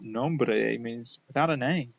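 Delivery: ordinary talk, nothing marked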